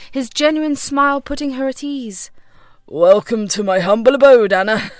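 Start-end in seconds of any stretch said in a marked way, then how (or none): 2.9-4.9 low, hearty welcome with a chuckle; warm welcome. A narrator's reading. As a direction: genial host greeting a guest. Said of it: "John welcomed her warmly".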